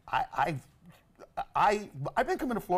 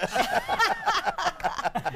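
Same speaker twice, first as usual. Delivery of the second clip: high mock laugh.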